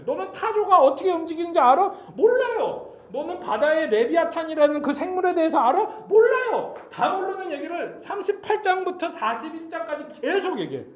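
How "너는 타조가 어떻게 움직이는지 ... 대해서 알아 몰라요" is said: high, loud questions answered with yelped replies; loud interrogation. Acted. God quizzes Job who knows nothing, acted high shouting. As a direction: hammer each question, then cry out the helpless answer. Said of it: "별의별 걸 다 물어보세요".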